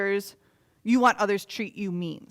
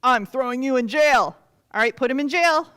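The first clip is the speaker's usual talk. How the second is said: commanding king; 3 semitones higher, 8 dB louder than usual. Acted. King orders jail.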